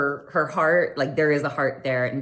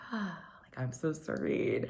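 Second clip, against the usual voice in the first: much softer, dreamy sigh; mock serene murmur; 11 dB quieter than usual. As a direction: drift off in bliss. Imitating Garcelle relaxed.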